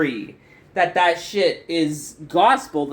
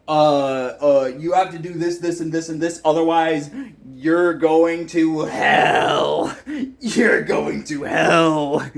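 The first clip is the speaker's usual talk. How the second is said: mock God; 3 semitones lower, slower than usual. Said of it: "God didn't come down and say".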